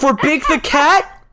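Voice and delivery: high, slow disbelieving question; incredulous. Real, not acted. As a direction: ask it in disbelief. Teasing repeat of request.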